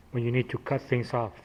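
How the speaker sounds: normal talking voice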